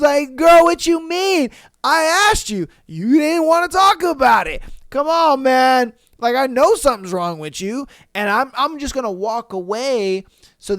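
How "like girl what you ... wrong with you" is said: louder, higher exasperated pleading. Retold or imitated. Boyfriend answering his upset girlfriend, loud and exasperated. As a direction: throw your hands up at her.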